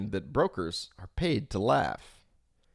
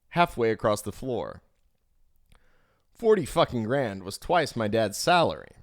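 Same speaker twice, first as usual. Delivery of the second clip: ordinary delivery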